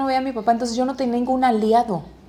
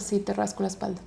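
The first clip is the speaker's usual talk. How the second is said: a hushed, gentle answer; soft, quiet reassurance; 11 dB quieter than usual. Retold or imitated. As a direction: say it softly to a child falling asleep. Mom soothing son to sleep. (mamá respondiendo suave a su hijo antes de dormir)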